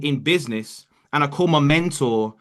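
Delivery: normal talking voice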